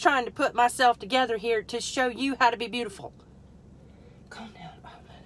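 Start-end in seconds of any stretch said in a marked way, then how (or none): 4.3-5.2 quiet self-calming whisper. Real, not acted. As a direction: whisper it to settle yourself down. Calming self while assembling.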